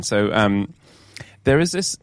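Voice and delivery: ordinary talk, nothing marked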